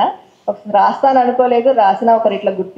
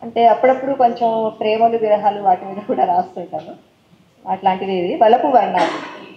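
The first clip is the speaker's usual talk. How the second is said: ordinary talk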